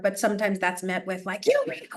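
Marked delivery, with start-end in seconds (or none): 1.5-1.9 put-on high squeaky kid snapping back; mock child retort. Parent imitating the child's angry comeback, high and mocking. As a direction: mimic a child's comeback, pitched up.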